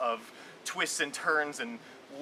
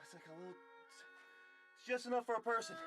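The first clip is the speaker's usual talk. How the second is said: crammed in a tight space; 8 semitones higher, 14 dB quieter, much faster than usual. Real, not acted. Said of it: "That's tight".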